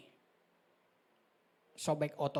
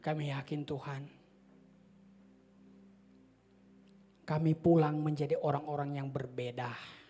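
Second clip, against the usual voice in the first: closing prayer. Acted.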